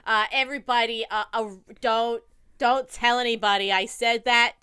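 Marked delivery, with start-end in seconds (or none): none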